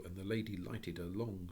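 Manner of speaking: ordinary delivery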